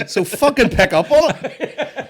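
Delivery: very high, loud, slow mock indignation; high, loud, slow mock complaint; hammed-up exasperation. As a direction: stretch it out in fake outrage.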